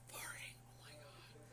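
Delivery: hushed, whispered complaint; disgusted whisper. Retold or imitated. Nauseous patient reacts to neighbor's farting, disgusted whisper. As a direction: whisper it under your breath, appalled.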